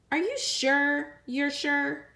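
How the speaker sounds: lightly voiced girl drawing out the question; pleading little girl. Lily coaxing Ginger to back out, slow hopeful plea.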